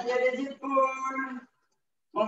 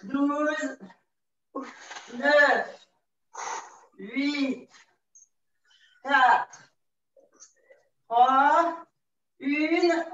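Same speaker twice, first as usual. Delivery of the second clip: counting workout reps; much slower than usual. Acted. Instructor counting down final seconds.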